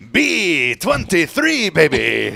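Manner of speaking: high, loud, drawn-out number call; bingo caller. Retold or imitated. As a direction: call it out with big energy. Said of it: "I could just see Delphina".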